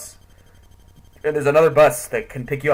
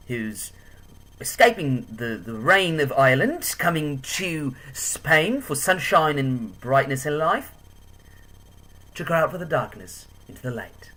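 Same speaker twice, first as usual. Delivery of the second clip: hammed-up, uncertain Irish lilt; attempted Irish accent; 3 semitones higher, slower than usual. Describing the Irish girl who guided the walking tour, mock Irish accent. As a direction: put on a shaky-at-best Irish accent, drawing words out. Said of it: "Not sure what the accent was".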